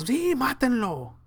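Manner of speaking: high, drawn-out mock crowd cry; stretched, high-pitched mock yell. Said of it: "Y sí, todos".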